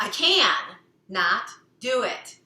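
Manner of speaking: "I can not do it" loud and slow with "can" and "not" split apart; stress on can and not. Shown as a demonstration. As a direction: hit "can" hard, pause, then "not". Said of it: "we're changing that tonality".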